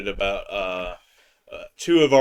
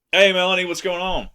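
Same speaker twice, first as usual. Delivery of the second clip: DJ voice; 7 semitones higher, 4 dB louder, faster than usual. Pretend. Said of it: "my best D J voice".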